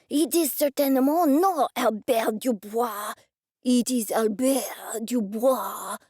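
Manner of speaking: put-on slow, heavy French accent, insisting; flustered French accent. The chef correcting Dennis about his name, flustered French accent.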